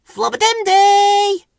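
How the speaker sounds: very high, loud, long-held squeaky call; piercing drawn-out flowerpot-man call. A narrator's reading. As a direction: holler the nonsense word afar. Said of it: "called Ben".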